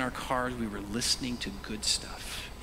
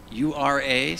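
usual speaking voice

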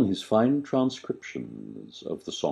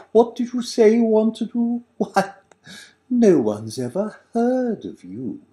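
mocking agent; 4 dB louder than usual.

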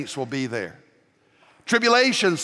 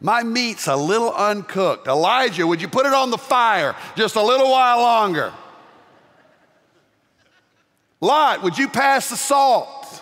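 raised, slowing to a loud shout on Lot; hammed-up dinner banter with the prophets; playful call across the table; 9 semitones higher, 4 dB louder than usual. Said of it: "you'll say something like".

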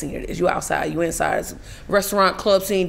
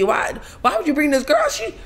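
high, flustered stuttering while retelling; mock panicked stammer; 9 semitones higher than usual. Acting how she was on set, flustered. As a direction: play up tripping over words, pitch climbing. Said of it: "that is how I'm on set".

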